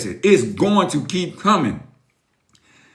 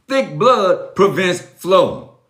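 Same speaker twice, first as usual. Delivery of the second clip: slow emphatic; much slower than usual. Shown as a demonstration. Slow punchline.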